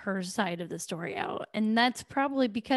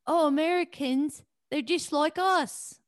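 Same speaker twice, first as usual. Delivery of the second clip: bad British accent; 6 semitones higher than usual. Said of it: "that was terrible accent work".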